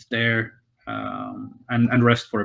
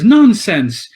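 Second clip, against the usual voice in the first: louder, higher dismissive nonsense; brisk objection; 6 semitones higher, 7 dB louder than usual. Retold or imitated. Innkeeper's wife overruling her husband, loud and brisk. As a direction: brush her husband's idea aside firmly. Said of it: "and she says".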